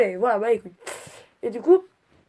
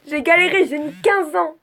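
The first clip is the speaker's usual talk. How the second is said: galéré thrown out louder and higher; loud exasperated complaint; 6 semitones higher, 7 dB louder than usual. Real, not acted. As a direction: burst out with galéré, fed up. Same complaint, quiet then loud. (galéré lancé fort et plus aigu, exaspérée)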